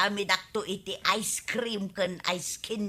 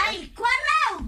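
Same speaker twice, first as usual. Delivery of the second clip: excited greeting; 4 dB louder than usual. Acted.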